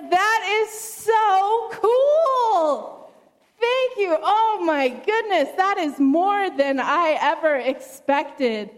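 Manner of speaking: high-pitched gushing delight, drawn out at first; thrilled snake. Acted. Snake thanking God for its abilities, thrilled. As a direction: gush like an overjoyed storybook snake.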